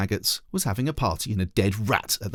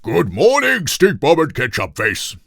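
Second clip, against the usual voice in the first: loud pompous grave greeting; 5 semitones higher, 7 dB louder than usual. Acted. King gravely greeting the children with bad news. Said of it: "he said gravely".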